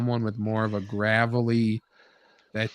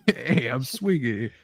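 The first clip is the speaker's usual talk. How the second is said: put-on Queens accent; slower than usual. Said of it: "a Queens accent".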